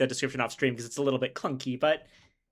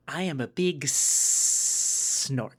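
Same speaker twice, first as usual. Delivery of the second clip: snake voice; much slower than usual. Pretend. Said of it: "Going snake voice".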